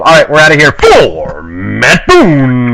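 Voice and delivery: drawn-out announcer call; radio sign-off shout. Acted. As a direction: stretch it out like a radio host.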